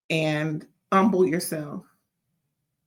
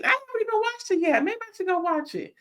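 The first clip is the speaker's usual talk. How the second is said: high mock voice; 13 semitones higher, faster than usual. Self-mocking aside, high voice.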